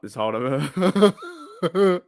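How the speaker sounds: drawn out very slowly through laughter; can barely get it out for giggling; laughing helplessly at a meme. Real, not acted. Cracking up at a meme.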